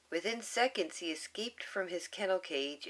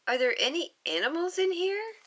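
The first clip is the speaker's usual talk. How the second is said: put-on much higher, louder child voice; little girl voice; 11 semitones higher, 5 dB louder, faster than usual. Reading to listeners as little Lilu, high and eager.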